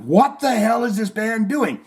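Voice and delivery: high, exasperated mock complaint; mock irritation. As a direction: act fed up and baffled. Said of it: "you're like".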